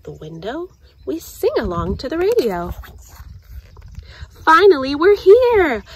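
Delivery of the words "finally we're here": high and loud with relief; excited arrival. Acted. Girl arriving at the campground, high and excited. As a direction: let it burst out at last. Arriving after long drive.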